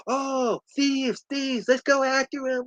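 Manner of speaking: put-on high-pitched kid raising the alarm; high, light call to give chase; mock alarm. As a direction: imitate an urgent little voice, kept high.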